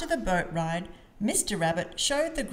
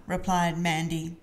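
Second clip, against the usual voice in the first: ordinary delivery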